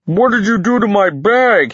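high, loud outburst; upset cry. A narrator's reading. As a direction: cry out in protest. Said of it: "he cried".

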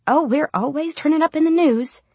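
high, playful voice lifting along the line; joking. A narrator's reading. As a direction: quip it lightly to company. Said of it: "she used to joke".

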